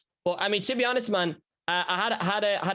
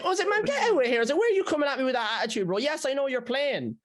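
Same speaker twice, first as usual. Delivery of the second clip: high, exaggerated indignant rant; mock outrage; 11 semitones higher than usual. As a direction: blast him in a shrill put-on voice.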